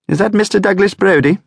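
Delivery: prim telephone operator, clipped and proper; posh operator. Acted. Operator asking for Brodie, posh and high. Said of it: "A posh voice, a professional voice, an operator's voice".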